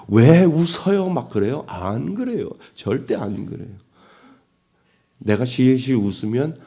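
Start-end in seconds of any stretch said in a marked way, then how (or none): 0.1-1.1 drawn-out, louder and higher mock-angry challenge. Bystander confronting someone who is giggling, mock angry. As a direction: imitate someone demanding an explanation, indignantly. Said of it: "막 화를 내면서".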